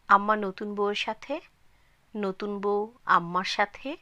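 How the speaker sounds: ordinary delivery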